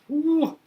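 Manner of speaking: loud high exasperated whew. Real, not acted. Parent sighing at how hard raising a deaf child is, loud whew. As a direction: blow out the strain of it.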